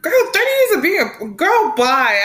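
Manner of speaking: high, loud mock brush-off; sassy dismissal. Real, not acted. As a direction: wave it away with sass. Dismissing the idea.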